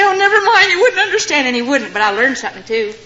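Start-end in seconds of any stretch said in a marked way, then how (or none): 0.0-1.5 higher, giggling mock dismissal; playfully waving off her husband's question with a laugh; coy laughing brush-off. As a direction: tease him through a laugh. Mock tearful wife brushing off husband.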